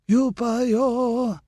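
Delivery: put-on high cracked elderly shout; cracked old man cry. A narrator's reading. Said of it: "in a cracked, elderly voice".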